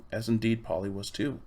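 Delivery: usual speaking voice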